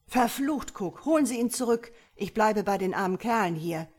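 loud, urgent command, starting high; shouted order. A narrator's reading. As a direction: curse and bark orders after a runaway. Officer shouts orders as man flees.

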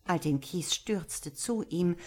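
ordinary delivery, nothing marked

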